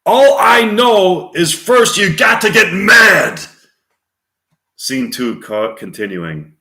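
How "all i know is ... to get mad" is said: loud, high, drawn-out furious shout; full-throated theatrical tirade; angry movie rant. Acted. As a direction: bellow it like a man who has had enough. Network rant read as film scene.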